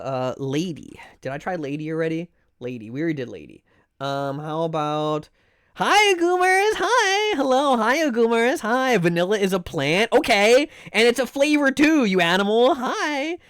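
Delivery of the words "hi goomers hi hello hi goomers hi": sing-song greeting. Real, not acted. Greeting an arriving viewer.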